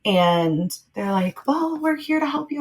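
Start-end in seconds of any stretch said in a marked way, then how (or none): 1.5-2.6 high, soft sing-out offer of help; lightly mocked cheerful newcomer; upbeat helper. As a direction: imitate a chirpy helper in a raised pitch. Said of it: "Like, very upbeat".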